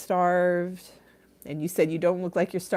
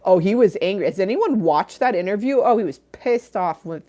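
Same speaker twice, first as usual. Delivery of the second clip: louder, higher, worked-up telling; excited; 4 semitones higher, 8 dB louder than usual. Real, not acted. Streamer fired up over Depp's anger, excited. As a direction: get fired up about the gossip. Excited about Johnny Depp.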